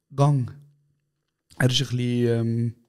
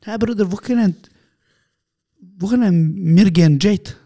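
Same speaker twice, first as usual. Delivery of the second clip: imitating friend; 5 semitones higher, 4 dB louder than usual. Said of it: "Er ist immer so".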